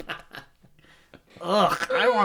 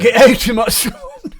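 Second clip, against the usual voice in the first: laughing Scottish shout, louder; Scottish accent; 4 semitones higher, 7 dB louder than usual. Shown as a demonstration. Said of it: "I thought I could do a Scottish accent".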